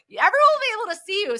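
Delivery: high, fast, loud mock announcement; hammed-up boss telling him he stands out; mock bossy manager. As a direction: imitate a boss calling out high and quick. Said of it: "they were like".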